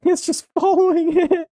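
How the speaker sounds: very high, stretched squeal of wonder; real high-pitched amazement; amazed squeal. Real, not acted. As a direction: squeak it out in disbelief, laughing. Amazed laughter at moving magnet contraption.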